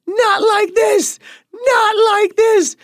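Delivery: very high, loud, slow shouted plea; mock shaking panic. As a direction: imitate her trembling refusal. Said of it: "She's shaking like".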